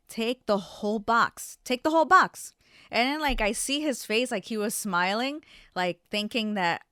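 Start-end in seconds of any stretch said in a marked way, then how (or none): 1.6-2.5 much higher and louder, snapping; exasperated outburst. Retold or imitated. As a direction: let the irritation burst out loud. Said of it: "And then I'm like".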